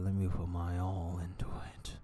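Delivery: slow voiceless whisper; whispered inner voice. Retold or imitated. Himself at work, slow whispered vow. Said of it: "I do be going at work like".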